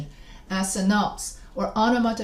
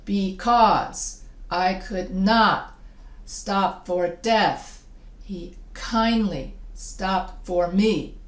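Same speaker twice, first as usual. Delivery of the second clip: slow scansion, leaning on "not", "death" and "me"; measured stress; much slower than usual. Shown as a demonstration. Teacher shows where to clap in the stanza, slow stressed rhythm. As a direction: draw out "not", "death" and "me" on the beat. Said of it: "So every time you stress".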